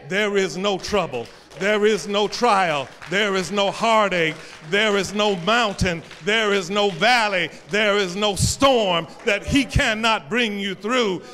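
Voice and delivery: loud, pounding, repeated refrain; preaching at full force. Acted. Preacher rousing the congregation, loud and driving. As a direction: build the list like a rising chant.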